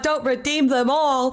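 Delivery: shouting through laughter; laughing shout. Real, not acted. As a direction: yell it while cracking up. Laughing at chat redeems.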